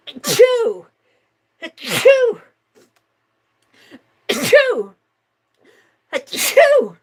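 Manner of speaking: loud repeated sneezes; sneezing. Real, not acted. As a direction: sneeze hard four times in a row. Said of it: "I'm gonna sneeze".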